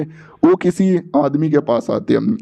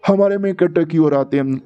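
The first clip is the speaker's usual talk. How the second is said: plain reading voice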